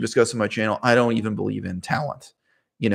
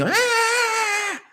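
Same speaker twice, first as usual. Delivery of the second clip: put-on kids screaming. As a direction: let out a shrill mock childish scream. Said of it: "the kids were screaming".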